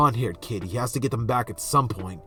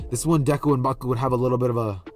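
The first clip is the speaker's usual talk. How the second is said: plain reading voice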